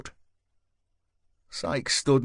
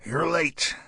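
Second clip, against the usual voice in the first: curt bark, a bit higher; 5 semitones higher than usual. A narrator's reading. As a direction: snap it out like a gruff sergeant. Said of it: "barked Stryker".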